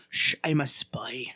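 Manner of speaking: low, slow secretive whisper; whispered spy. Acted. Demonstrating the occasional pop into Russian, spy whisper. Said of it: "popped in with the Russian".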